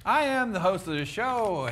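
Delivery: high, loud show-opening holler; show announcer. Pretend. Host introducing the show and co-host, high loud announcer voice.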